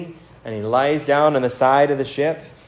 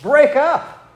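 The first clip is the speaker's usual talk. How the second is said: incredulous captain; 6 semitones higher than usual. Retold or imitated. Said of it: "It's kind of like".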